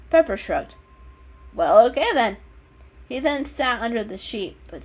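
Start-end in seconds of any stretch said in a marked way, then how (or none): 1.5-2.4 shrugging. Acted. Pepper shrugs.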